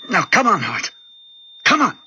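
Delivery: high-pitched, exasperated doctor; frantic urging. Acted. Doctor urging a stopped heart.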